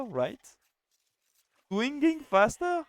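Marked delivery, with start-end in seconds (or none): 1.7-2.8 high, slow, disbelieving "Swinging faster?"; can't believe that's the word; real surprise at a strange word, rising high; incredulous question. Real, not acted. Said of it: "That's really the word?".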